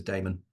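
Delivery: "Damon" with first syllable like "day"; preferred pronunciation. Shown as a demonstration. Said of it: "I say it as".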